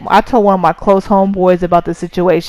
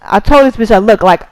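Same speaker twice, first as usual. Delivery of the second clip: usual speaking voice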